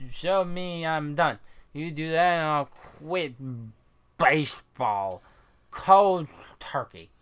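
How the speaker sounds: put-on slow, slurred drunken vow; hammed-up boozy challenge; belligerent drunk ballplayer. Voices drunk game character.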